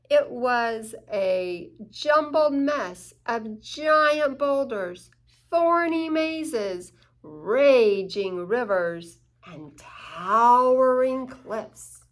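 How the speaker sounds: slow and loud, each obstacle weighed; slow grand storybook emphasis; slow dramatic build. A narrator's reading. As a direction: lay out every obstacle slowly, booming.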